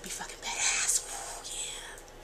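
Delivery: whispered, quick excited aside. Real, not acted. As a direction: lean in and whisper the secret thrill.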